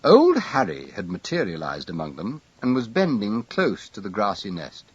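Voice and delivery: ordinary delivery, nothing marked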